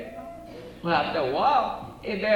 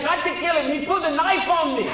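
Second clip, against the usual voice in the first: excited protest; 7 semitones higher, 4 dB louder, faster than usual. Acted. Bartender defends himself.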